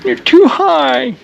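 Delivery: high, whiny fake crying; hammed-up sobbing over the armrests; mock sad. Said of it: "I'm kinda sad".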